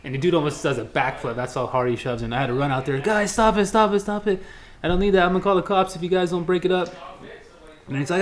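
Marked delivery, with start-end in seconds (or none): 3.0-6.9 retold pitched-up call to stop a fight; mild mock yell to two brawling golfers. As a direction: break it up, firm and raised. Breaking up a fight.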